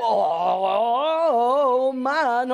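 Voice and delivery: strained cries squeezing through; groaning effort climbing through a window; straining effort. Acted. Anja groans squeezing through the narrow window.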